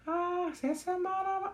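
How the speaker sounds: put-on high quavering old man's stammer; imitating old man. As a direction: stammer it out like a frail grandpa.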